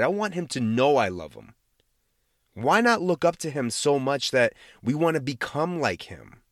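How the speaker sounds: usual speaking voice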